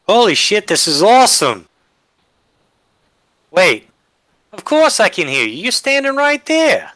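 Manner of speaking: loud, high-pitched gleeful outburst; excited amazement. Acted. Player reacts in character to earpiece.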